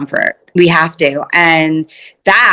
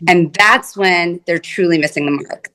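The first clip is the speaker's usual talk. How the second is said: usual speaking voice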